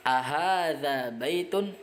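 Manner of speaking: Ahadza baitun drawn out slowly as a question; teacher modelling Arabic yes-no question; slow model question. Shown as a demonstration. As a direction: read the question slowly for students. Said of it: "apakah ini rumah?".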